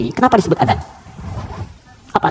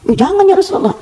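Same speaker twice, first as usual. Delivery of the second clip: put-on pleading young man. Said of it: "seorang minta izin".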